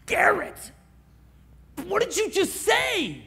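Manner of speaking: high, loud startled outburst; freaked-out shout. Retold or imitated. Friend shouting at the pastor over the chick flick remark, freaked out. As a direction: shout it like you can't believe your ears. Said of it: "Bobo freaks out".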